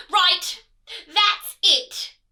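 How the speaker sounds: loud, high royal outburst; shouting queen. Acted. Queen losing patience with her son, shouting. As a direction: explode with exasperation. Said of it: "shouted the Queen".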